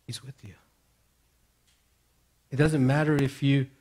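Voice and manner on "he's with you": much softer, almost whispered; quiet comfort to listeners; hushed. Acted. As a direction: pull right back to a hush. Hushed reassurance in sermon.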